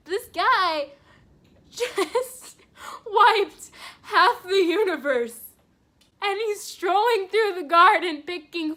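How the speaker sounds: high, loud, laughing through the words; laughing disbelief. Real, not acted. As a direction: crack up as you describe it. Cracking up recalling garden scene.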